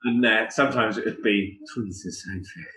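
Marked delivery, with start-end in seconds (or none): 1.7-2.6 soft, much quieter, camp German line; a camp colonel giving the order; camp delivery. Acted. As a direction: purr it, all camp and coy. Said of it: "sometimes I did it really camp".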